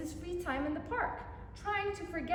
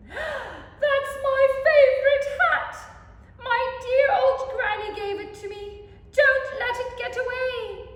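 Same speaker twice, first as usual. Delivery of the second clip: high, loud frantic plea; panicked cry; 10 semitones higher, 12 dB louder than usual. Acted. Teacher losing her granny's hat, high loud panic. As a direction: shriek as the hat blows away.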